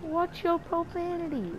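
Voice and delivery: high, mock prim scolding. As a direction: pretend to be shocked by a swear. Scolding a swearing voice.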